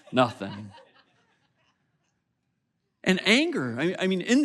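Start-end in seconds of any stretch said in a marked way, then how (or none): 0.1-0.7 a drawn-out, sulky "Nothing" through a laugh; hammed-up passive aggressive sulk; mock sulky nothing, laughing. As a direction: play the martyr, laughing. Passive aggressive sulking reply.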